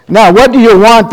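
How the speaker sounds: loud, high, irritated demand; angrily. Acted. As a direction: bark the question like an annoyed innkeeper. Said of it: "the woman asked angrily".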